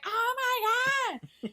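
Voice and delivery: very high, slow shocked gasp; mock gasp. Kid sees the footprint, high slow shocked gasp. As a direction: stretch it out in horror.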